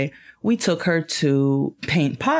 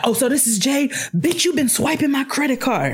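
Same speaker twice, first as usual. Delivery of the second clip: mock angry caller; 8 semitones higher than usual. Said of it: "You could tell she's angry".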